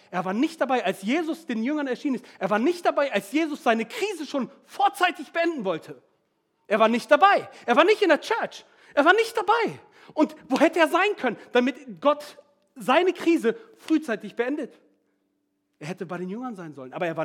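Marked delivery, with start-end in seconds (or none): 2.4-8.7 louder and higher with each repetition; building preaching. Real, not acted. 8.9-9.8 "Er war nicht dabei." much higher and louder, ringing out; emphatic preaching. Shown as a demonstration. 10.1-14.8 louder and higher with each repetition; building preaching. Real, not acted.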